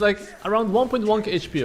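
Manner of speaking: ordinary talk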